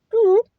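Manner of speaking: high, loud "doop"; high vocal blip for slipping away; imitated exit noise.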